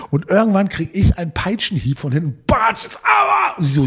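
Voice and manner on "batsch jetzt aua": much higher sudden ouch cry; hammed-up whip crack and yelp; mock pain yell. Whip hits him from behind.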